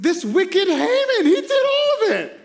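very high, loud, slow accusation; accusing Esther. Retold or imitated. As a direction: point at the villain in a shrill-pitched outcry.

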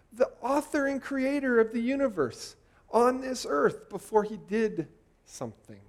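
ordinary delivery

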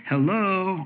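usual speaking voice

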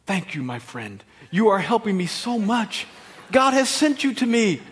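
mock gratitude. Preacher plays someone thanking a correcting friend, mock-grateful. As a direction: overdo the thankfulness, building with each phrase. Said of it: "when your friend comes and tells you".

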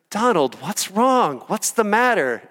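high, slow, sing-song mother's voice; sweet mom to a toddler. Retold or imitated. Mom talks sweetly to child.

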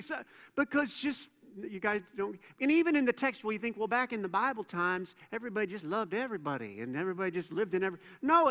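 ordinary delivery

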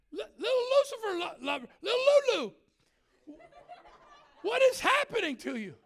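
high-pitched, stammering mock alarm; startled exclamation. Preacher asks screaming girl what's happening, high startled stammer. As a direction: trip over the words, then squeal the question.

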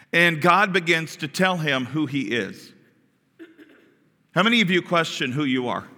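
ordinary talk

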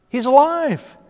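high, loud burst of joy; excited exclamation; joyful discovery. Acted. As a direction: cry it out in sudden delight. Mary's joyful realization.

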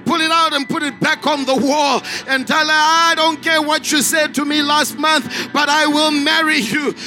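usual speaking voice

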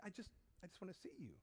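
very soft, hurried and tender; quiet fatherly plea. Acted. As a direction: murmur it gently to your child. Father pleads quietly with child.

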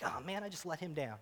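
very soft, low, crestfallen; quiet dejection. Retold or imitated. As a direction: sigh it to yourself, deflated. Dejected after losing the fish.

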